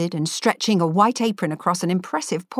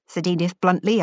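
ordinary delivery

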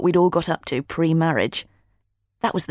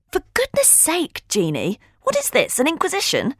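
exasperated; 10 semitones higher than usual. A narrator's reading. Holly objects to friend's nosy questions, exasperated. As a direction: protest the grilling, pitch soaring.

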